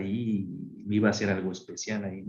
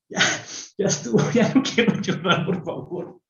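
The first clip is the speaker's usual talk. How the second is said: pleading quote; 8 semitones higher than usual. Retold or imitated. Begging to stop crying.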